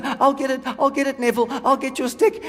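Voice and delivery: high-pitched keen dog voice; eager dog. Acted. Comedian as the dog chasing a thrown stick, eager. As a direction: play a devoted, dopey dog.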